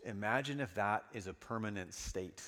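ordinary talk, nothing marked

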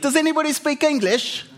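high-pitched, plaintive comic plea; lost foreigner calling out. Acted. Man lost in a Turkish bazaar, high-pitched comic plea. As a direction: act out a hapless traveler appealing to strangers. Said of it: "And he's like".

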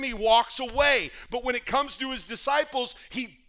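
loud, urgent, fast-building sermon voice; full-force preaching. Acted. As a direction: preach it hard to the church.

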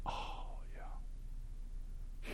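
breathy whispered oh yeah; soft awed whisper of understanding; hushed realization. Retold or imitated. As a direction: whisper it as the penny drops. Student quietly realizing, light goes on.

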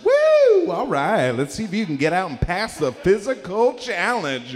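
high, loud mock host hype; game show host. Police as game show host, hyping the challenge. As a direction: announce it like a hammed-up host. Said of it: "it's like a game show".

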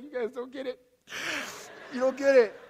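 high, choked-up imitation of a crying parent; mock tearful. Crying parent to puzzled kids, mock tearful protest. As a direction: protest through put-on tears, pitch climbing. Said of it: "What are you crying for".

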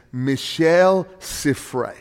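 the name Michel Siffre said slowly in a hammed-up French accent; a playful stab at saying Michel Siffre the French way. Shown as a demonstration. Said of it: "for my Southern French accent".

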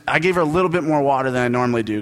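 ordinary talk, nothing marked

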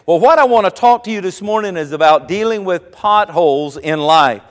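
ordinary talk, nothing marked

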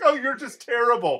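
hammed-up wounded accusation; mock tearful insult. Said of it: "starts crying and starts insulting him".

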